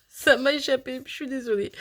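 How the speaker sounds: sheepish laugh at losing the thread; laughing apology. Real, not acted. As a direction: apologise through a little laugh. Lost her thought, laughs apologising.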